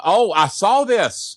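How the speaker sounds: high, slow, stretched-out I saw this; drawn-out exclaim. Pretend. To his cohost, recognizing the clip, high and drawn out. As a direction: sing out saw long and high.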